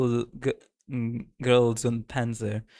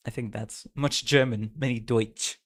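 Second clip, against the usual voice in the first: normal talking voice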